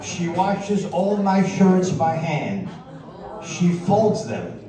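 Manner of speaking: ordinary talk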